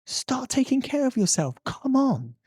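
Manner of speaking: pleading, insistent pep talk; urgent wake-up plea. Acted. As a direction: shake them up with your voice. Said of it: "shake people up and go".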